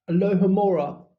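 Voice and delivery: ordinary delivery, nothing marked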